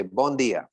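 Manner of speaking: "Bon dia" said the Portuguese way, bon nasal; Portuguese greeting. Shown as a demonstration. Teacher models "Bon dia" for the audience, clearly. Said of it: "repeat after me".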